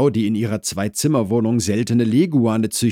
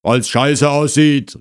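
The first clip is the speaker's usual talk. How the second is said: slow, loud shouted retort; called out; 8 semitones higher, 7 dB louder than usual. Acted. Husband explains boot rule from inside, shouting slowly. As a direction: holler the reason, drawn out. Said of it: "rief der Mann".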